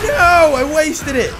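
real high, loud cry of dismay; dismayed yell. Real, not acted. Wasted item during play.

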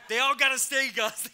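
loud, high retold insistence; playful shouted objection; emphatic protest. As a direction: plead the case at full volume, half laughing. Mock protest to colleague, retold.

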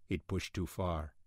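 ordinary delivery

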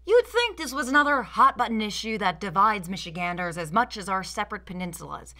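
normal talking voice

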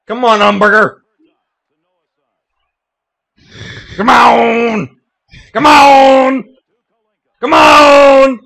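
loud, high yell at the game; frustrated shout. Real, not acted. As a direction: holler at your player to come through. Yelling at player in game.

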